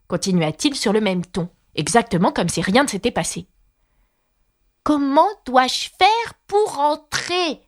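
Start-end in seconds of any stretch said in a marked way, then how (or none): 4.8-7.6 slower, higher, insistent question; Alice pressing the footman harder; raised voice. A narrator's reading. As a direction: raise the voice to be heard. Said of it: "demanda Alice en élevant la voix".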